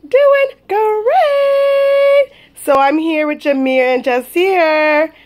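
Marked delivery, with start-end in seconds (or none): 0.1-2.3 put-on very high, slow baby voice; baby voice answer.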